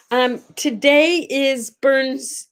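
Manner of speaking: usual speaking voice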